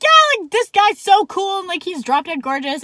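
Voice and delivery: loud, high, excited rave; gushing. Retold or imitated. Woman telling her friend about the cop, gushing. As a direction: gush to your best friend about a crush.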